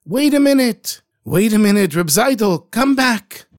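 high, loud call after someone. Acted. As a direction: shout to stop him leaving. Running after him, calling out.

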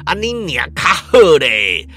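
slow, loud, menacing Taiwanese curse; snarling curse. Acted. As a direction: growl the insult down at him. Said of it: "蹲下去骂道".

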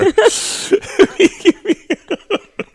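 breathless high laugh; high laughing fit. Real, not acted. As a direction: burst out laughing. Laughing after choking.

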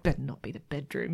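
low, quiet mock-menacing voice; jealous mutter. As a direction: grumble it low as a suspicious lover. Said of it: "even as he's watching, he's like".

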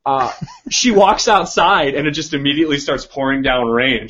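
normal talking voice

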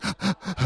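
sharp high gasp for breath, imitating a sob; sobbing gasp. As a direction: mimic a kid choking on sobs. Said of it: "couldn't catch his breath crying".

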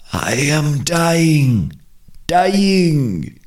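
high, slow, drawn-out dying moan; a trapped spirit crying out weakly; creature in distress. A narrator's reading. Trapped Kalunga Ngombe begging in the bush, high slow moan. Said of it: "a cry as of some creature in distress".

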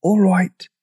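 high-pitched, voiced stage whisper; small high dinosaur voice; tiny meek voice. A narrator's reading. Dinosaur agreeing to tiptoe, tiny meek voice. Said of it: "whispered the dinosaur".

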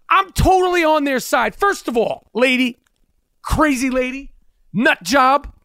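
ordinary talk

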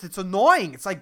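usual speaking voice

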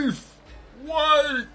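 calling out. Acted. Calling after fleeing guard.

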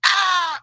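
high-pitched mimicked toddler scream; imitated kid's yell. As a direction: let out a child's happy squawk. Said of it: "He's just like".

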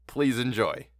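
plain reading voice

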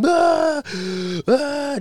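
loud, high mock crying howls; imitated toddler howl. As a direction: ham up a little boy losing it. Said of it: "Just losing it".

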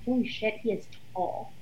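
hushed, breathless holy shit; awed whisper. Retold or imitated. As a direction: whisper it like you can't believe his height. First sight of tall Peter.

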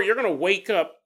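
ordinary talk, nothing marked

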